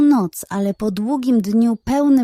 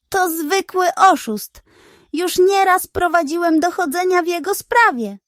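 higher, louder detective character voice; detective's voice; 8 semitones higher, 3 dB louder than usual. Acted. Detective exposes the fraud to villagers, higher louder accusing voice. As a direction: voice the pig detective, confident and accusing.

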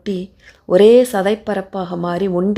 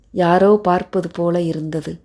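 plain reading voice

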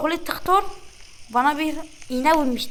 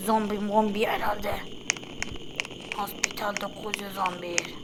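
whisper; 7 semitones lower, 8 dB quieter than usual. Real, not acted. Muttering to himself while playing.

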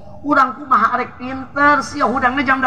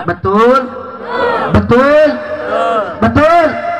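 calling out; 2 semitones higher, 4 dB louder, much slower than usual. Real, not acted. Preacher quizzing the audience.

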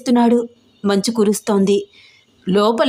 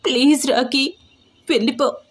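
tearful plea; 3 semitones higher than usual. Acted. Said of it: "కన్నీళ్లు తుడుచుకుంటోంది".

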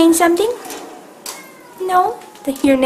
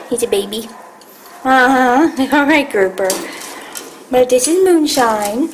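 ordinary talk

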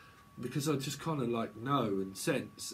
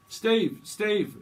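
loud high call of a name; 7 semitones higher, 7 dB louder than usual. Pretend. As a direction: shout for Steve across the room. Calling out to Steve.

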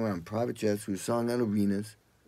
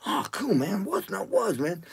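eager cool reply; 9 semitones higher than usual. Retold or imitated. Excited reply to phone call.

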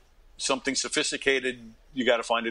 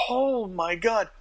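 panicked oh my god; 8 semitones higher, much slower than usual. Retold or imitated. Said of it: "So I was like".

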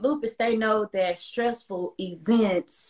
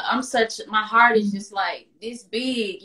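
ordinary talk

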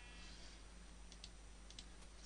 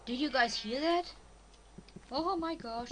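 excited; 6 semitones higher, 4 dB louder than usual. Real, not acted. Hears a cave sound.

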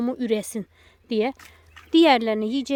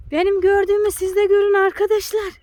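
excited; 7 semitones higher, 5 dB louder than usual. Real, not acted. Excited calling to viewers.

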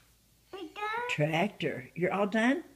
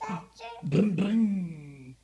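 playful boom; 3 semitones lower, 5 dB louder, much slower than usual. Acted. Playing with toddler, sound effect.

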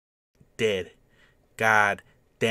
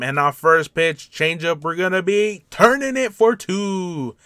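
louder, higher, building to the big out; excited play-by-play call; 6 semitones higher, 7 dB louder than usual. Real, not acted. Gamer calling his own double play, excited. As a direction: crow the double play as it happens.

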